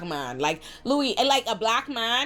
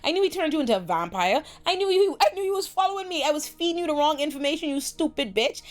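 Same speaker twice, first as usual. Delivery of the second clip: high, fast, gloating voice; Claudia gloating; 7 semitones higher than usual. Retold or imitated. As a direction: rattle it off high and smug. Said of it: "Claudia was like".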